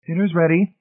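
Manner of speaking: high-pitched, sharp summons; sharp call. A narrator's reading. Marcia calling everyone to dinner from the living room, sharp. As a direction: call sharply through the house. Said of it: "Marcia called sharply from the living room".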